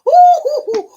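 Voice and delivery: real overcome excitement; overwhelmed. Real, not acted. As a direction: gasp with overwhelmed joy. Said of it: "breathe for me".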